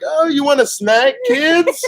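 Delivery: high, slow, loud-ish cover-up; overly casual high call to the kids; kinda loudly. Retold or imitated. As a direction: sing it out for passers-by to hear. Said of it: "Like, kinda loudly".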